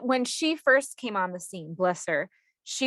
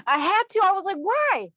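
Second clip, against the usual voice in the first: laughing high; 12 semitones higher, 6 dB louder than usual. Real, not acted. Laughing at her own curiosity.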